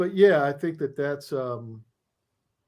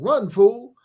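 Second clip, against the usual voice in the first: dramatic yell; 8 semitones higher, 5 dB louder than usual. Shown as a demonstration. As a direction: punch out Fool with rising energy. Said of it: "You gotta say it like that".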